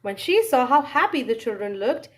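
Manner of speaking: usual speaking voice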